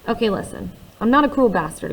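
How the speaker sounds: ordinary delivery, nothing marked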